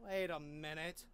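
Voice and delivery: usual speaking voice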